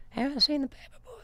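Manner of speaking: failed impression in a very high, faint whisper; high wheezy whisper, voice giving out; Herbert the Pervert. Attempting an impression on a dare, high whispery character voice. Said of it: "Herbert the Pervert".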